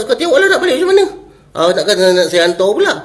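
high, loud fed-up comeback; retold irritated outburst; exasperated retort. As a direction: throw it back in exasperation, voice shooting up. Retelling his fed-up retort to boy.

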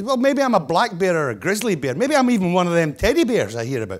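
joke-telling cub voice, mildly hammed-up; lightly voiced bear cub. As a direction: lightly play a doubting little bear. Acting little bear in joke.

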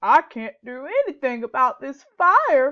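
loud, lightly voiced frog croak; a hopeless frog giving up; a hint of a croaking frog. A narrator's reading. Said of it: "croaked Frog".

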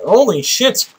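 high sudden holy shit, jolted; startled yelp. Real, not acted. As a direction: blurt it out, caught off guard. Another sudden game surprise.

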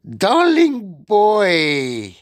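high, drawn-out sugary coo; cooing witch. A narrator's reading. Grand High Witch luring a boy from the platform, cooing. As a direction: coo it loudly from the platform, stretched slow. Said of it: "cooed the Grand High Witch".

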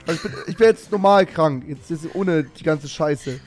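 ordinary talk, nothing marked

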